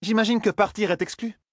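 very high-pitched character voice; haughty Zarine. A narrator's reading. Huntress asks about her own case, high and haughty. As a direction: play a self-assured huntress, pitched right up.

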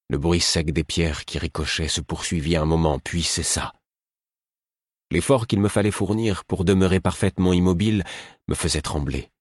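ordinary delivery, nothing marked

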